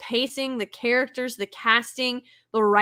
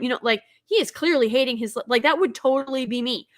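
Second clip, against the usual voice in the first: ordinary delivery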